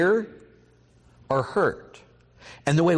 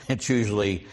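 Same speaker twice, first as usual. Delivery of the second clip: normal talking voice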